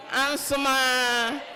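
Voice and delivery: Ansuma drawn out slowly as a call; slow call word. Shown as a demonstration. Host teaches the room the Ansuma call, drawn out. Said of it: "when I say Ansuma".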